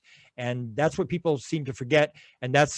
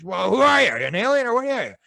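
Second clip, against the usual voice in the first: incredulous demand; 9 semitones higher, 5 dB louder, faster than usual. Retold or imitated. Woman retells confronting the being.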